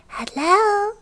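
very high little creature voice. Acted. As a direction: pipe up as a tiny goblin. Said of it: "a little voice".

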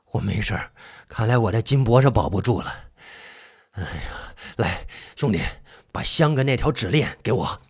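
low, soft, weary voice; spent fifth brother. Acted. Fifth brother asking brother for incense, wearily. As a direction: sigh it out low and tired. Exhausted friend reassures.

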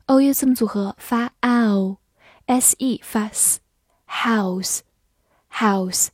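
slow, drawn-out house; slow reading. Shown as a demonstration. Teacher slowly modelling house for students. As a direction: stretch out each sound.

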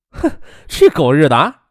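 scornful swearing after a snort; snort, then spit out the curse; cursing. A narrator's reading. Friend hearing about the student chairman, cursing him. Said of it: "杜飞骂了一声".

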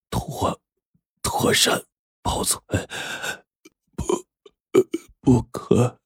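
faint, broken whisper, fading breath; dying words. Acted. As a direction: gasp out the last words, barely audible. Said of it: "断断续续地说". (临终时断断续续的微弱耳语)

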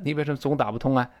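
normal talking voice

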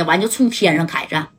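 ordinary delivery

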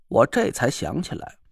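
ordinary delivery, nothing marked

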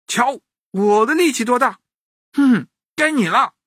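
put-on high, cocky child's voice; boastful boy. A narrator's reading. Boy shows the mantis his strength, boastful. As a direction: show off, then dare the rival.

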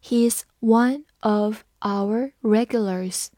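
slow, careful read-along. Shown as a demonstration. Teacher reading slowly for students to repeat. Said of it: "我们慢读一遍".

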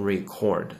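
record said as ri-CORD, stress on the second syllable; the wrong way: record as the verb ri-CORD. Shown as a demonstration. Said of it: "千万不要读成".

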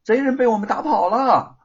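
high, loud boastful brag; proud. A narrator's reading. Boy boasting to the neighbours, proud brag. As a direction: crow about the victory. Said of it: "凤毛得意地说".

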